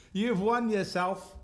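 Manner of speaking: high, slow, mock Scottish accent. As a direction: stretch it out in a playful Scottish accent. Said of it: "I don't know why I became Scottish".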